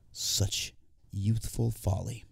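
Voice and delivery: slow, soft, wry regret; rueful. Acted. Old narrator judging his younger self, slow rueful aside. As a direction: shake your head at the boy you were.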